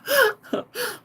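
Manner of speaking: high-pitched helpless giggle; real laughter squeezed up high; laughing. Real, not acted. Storyteller still cracking up over aisle four, high laughter.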